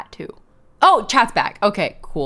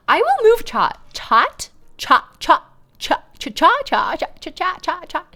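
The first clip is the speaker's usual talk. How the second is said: higher, louder posh British voice saying "chat"; 9 semitones higher, 5 dB louder than usual. Shown as a demonstration. Streamer practising "chat" in a posh British accent, higher and louder. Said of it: "I don't know the vowel sound for that".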